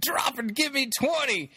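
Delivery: high barked drill command; drill sergeant. Retold or imitated. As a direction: bark it like a drill instructor. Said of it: "this lady could take Rex Kwon Do".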